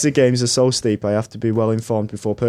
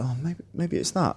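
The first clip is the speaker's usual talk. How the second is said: quiet wondering; 5 semitones higher, 8 dB quieter than usual. Retold or imitated. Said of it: "I was like".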